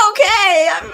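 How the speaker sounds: high, loud, tearful mock shout; mock emotional outburst. Host imitating Joy's overwhelmed cry at her ceremony. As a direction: blurt it out half-crying, imitating her. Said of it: "I remember when you were like".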